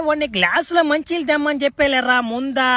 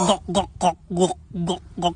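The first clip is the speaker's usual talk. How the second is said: gulping sounds; 7 semitones lower, 5 dB quieter than usual. Acted. Drinking the potion again.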